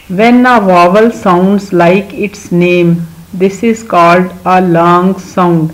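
very slow, deliberate English dictation; slow rule reading. Shown as a demonstration. Teacher to young pupils, reading the rule very slowly. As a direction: spell out the rule slowly for kids. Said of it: "teacher reads rule slowly".

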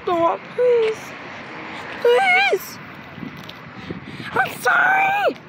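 high, crying please; whining sorry pleas; tearful begging. Acted. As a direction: beg with a breaking high voice.

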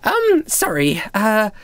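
put-on high, hesitant girlish apology; bubbly young girl. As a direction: apologize slowly in a high girl's voice. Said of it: "she's sort of bouncing from one foot to the other".

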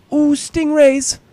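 high, squealing excitement; giddy delight. Real, not acted. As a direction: squeal with glee at each animal. Delighted by animals in game.

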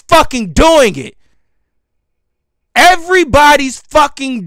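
fed-up, forceful rant; really pissed off. Real, not acted. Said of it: "I told y'all I'm pissed".

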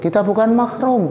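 very high, flustered protest; panicky mock plea. Cornered young man protesting to princess, comically panicked. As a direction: squeak out the objection.

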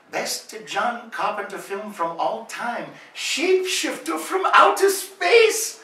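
put-on slow, high, excited Japanese-accented friend; Japanese accent impression. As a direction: gush it in a broken-English Japanese accent, rising to a cry. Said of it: "He mumbles something in Japanese".

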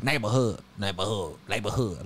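imitating L.A. homies, sinking low and quiet; L.A. homie accent. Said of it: "All the L A homies be like that".

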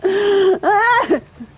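high, loud laugh-cry; shrieking laugh. Real, not acted. Streamer shrieks after being poisoned in the face. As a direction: squeal half laughing half crying.

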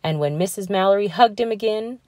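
plain reading voice

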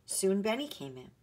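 plain reading voice

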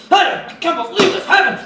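high, strained retold rant; mock furious high pitch; upset outburst. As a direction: voice an upset friend boiling over. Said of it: "but he was so upset".

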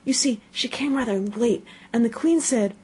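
high, hushed rabbit voice; frightened. A narrator's reading. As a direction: warn her nervously in a high voice. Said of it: "whispered in a frightened tone".

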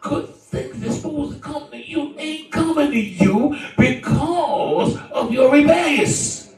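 high, loud, sustained preacher's cry; full-force preaching. Real, not acted. Preacher warning the rebellious of lost blessings, full-force preaching. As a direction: drive it at the congregation, pitched high.

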